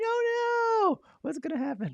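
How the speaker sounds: high-pitched playful panic; mock nervous. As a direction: squeal it in pretend worry. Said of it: "they get a little nervous".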